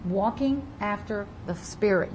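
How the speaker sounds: usual speaking voice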